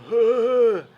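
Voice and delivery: high, loud wordless "huuu"; imitated winded groan. Narrator imitating winded passenger after the crash, mock groan. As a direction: mimic a winded man's wheezy moan. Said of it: "mutters some".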